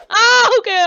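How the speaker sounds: loud, high cry under attack; alarmed yelp. Pretend. Backing off from an angered Slush, alarmed yelp. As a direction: yelp and give in at once.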